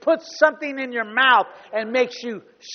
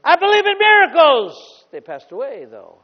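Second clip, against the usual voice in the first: mocking doubter; 7 dB louder than usual. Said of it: "I don't say".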